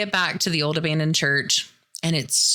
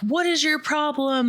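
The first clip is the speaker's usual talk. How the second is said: high-pitched mock yell; retold yelling; 10 semitones higher than usual. Couple yelling at the ramming truck, high mock yell. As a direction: yell it at the driver in a panic. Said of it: "They're both yelling".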